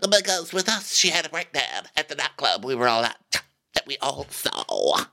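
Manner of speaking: high, put-on diva voice; exaggerated housewife impression, dropping off last; Erica. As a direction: start high and affected, sinking at the end. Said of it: "And so then Erica tells us".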